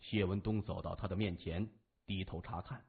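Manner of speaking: usual speaking voice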